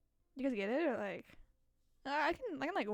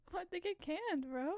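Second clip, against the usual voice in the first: laughing question; 5 semitones higher than usual. Real, not acted. Laughing at a pun.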